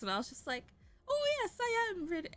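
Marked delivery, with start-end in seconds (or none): 1.1-2.3 high-pitched, badly faked British accent; terrible British accent. Improviser cringing at a sleepy midnight scene, bad British accent. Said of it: "the worst midnight British accent ever".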